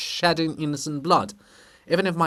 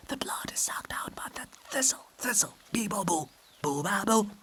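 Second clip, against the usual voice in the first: whisper; 3 semitones higher, 7 dB quieter than usual. Acted. Chorus chanting eerie refrain.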